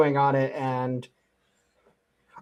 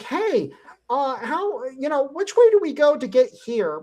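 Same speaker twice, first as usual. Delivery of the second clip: high-pitched impression of tourists asking directions; imitated tourists; 10 semitones higher than usual. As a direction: ask lightly in a much higher voice, like a lost visitor.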